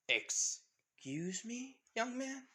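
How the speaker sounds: slow, high, rising challenge; offended mother. Acted. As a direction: draw it out in stern disbelief. Offended mother challenges her son.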